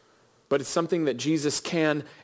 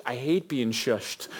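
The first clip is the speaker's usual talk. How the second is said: normal talking voice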